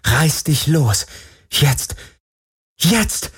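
high inner urging that climbs; urgent thought. Acted. Captive heroine willing herself to break free, urgent thought. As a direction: build pitch on each self-command. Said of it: "dachte sie".

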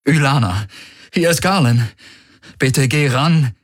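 feigned distress. A narrator's reading. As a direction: lay on the grief as you call her. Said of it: "legte er allen Kummer, den er heucheln konnte, in seine Stimme".

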